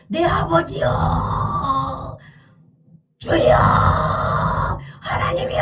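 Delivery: long, drawn-out desperate calls; acted crying out. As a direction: cry out to heaven, stretching each ending. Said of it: "그리고 막 소리를 질러요". (길게 늘여 부르짖듯)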